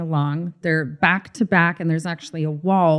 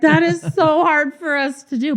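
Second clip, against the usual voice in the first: much higher, mock-exasperated exclaim; a playful, high mock cry of despair; excited reaction; 9 semitones higher, 3 dB louder than usual. Host retelling her own reaction to Beth, high and excited. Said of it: "And I was like".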